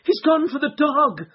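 high, loud alarmed cry; frightened cry. A narrator's reading. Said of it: "cried Miss Hunter".

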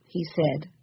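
ordinary delivery

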